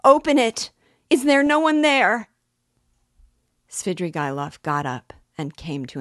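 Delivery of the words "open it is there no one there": high, loud desperate call; urgent cry, pitched way up; shouting for help. A narrator's reading. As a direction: shout out to anyone within earshot. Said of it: "it's a waste of time to shout like that".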